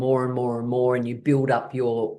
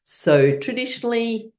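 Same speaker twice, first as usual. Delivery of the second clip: ordinary delivery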